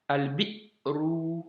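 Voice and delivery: "al-bi'ru" very slowly, a stop after "bi"; "al-bi'ru": "al", "bi", catch, "ru"; sukun stop. Shown as a demonstration. Teacher's last example "al-bi'ru" very slowly, stop after bi. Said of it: "petit blocage".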